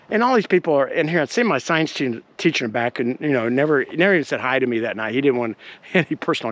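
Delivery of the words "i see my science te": normal talking voice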